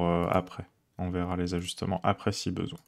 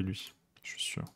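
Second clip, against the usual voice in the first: whisper; 14 dB quieter than usual. Real, not acted. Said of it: "whispered teasing aside".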